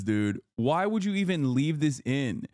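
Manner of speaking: ordinary talk, nothing marked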